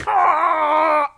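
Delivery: high, drawn-out mock scream; high wailing "ah"; imitated chat scream. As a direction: scream like an outraged crowd, hammed up.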